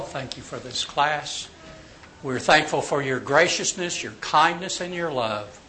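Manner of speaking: low, soft, reverent prayer; quiet, unhurried thanks to God; closing prayer. Real, not acted. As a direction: pray gently over the class.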